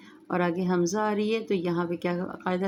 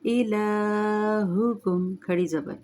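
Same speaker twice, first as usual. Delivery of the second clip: slow melodic tajweed chant; Quran recitation; 2 semitones higher, much slower than usual. Shown as a demonstration. Recites with rules.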